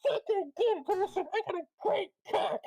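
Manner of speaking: put-on high, shrieking drunk brag; drunk yelling. [name] yells at his reflection, shrieking drunk brag. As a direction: howl it, laughing at yourself. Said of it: "hear him yelling this".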